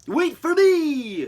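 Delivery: high, loud, slow call; drawn-out high cry. Acted. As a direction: shout after the fire engine. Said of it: "cried Bullwinkle".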